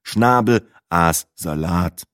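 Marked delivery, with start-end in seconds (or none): none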